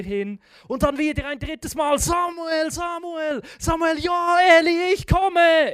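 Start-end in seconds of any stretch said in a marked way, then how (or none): 2.0-4.4 high, loud booming calls; voice of God calling. Acted. God's third call. 4.6-5.7 high, loud hurried call; eager call. Acted. Samuel running to Eli.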